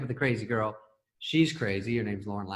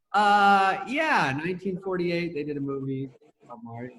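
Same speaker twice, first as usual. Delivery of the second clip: Chris; 5 semitones higher than usual. Retold or imitated. Writer with no ideas explaining his pitch, awkwardly. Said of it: "And he goes".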